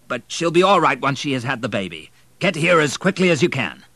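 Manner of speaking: reassure, then urge haste; urgent doctor. A narrator's reading.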